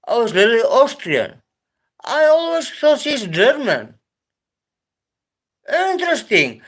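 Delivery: real surprise, high and loud; surprised. Real, not acted. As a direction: pitch jumps way up, amazed. Learns Lily is Austrian.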